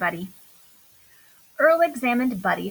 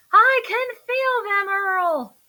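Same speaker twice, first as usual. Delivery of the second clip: much higher, louder shout; 9 semitones higher, 4 dB louder, slower than usual. A narrator's reading. Buddy shouting back to Earl in the storm, high and loud. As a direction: yell back through the waves. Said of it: "shouted Buddy".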